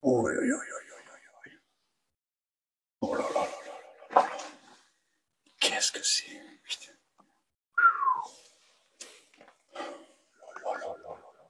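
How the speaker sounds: real whispered, uneasy exclamations; hushed alarm. Real, not acted. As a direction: mutter it very softly, almost without voice. Uneasy, treading carefully in ruins. (chuchotement inquiet)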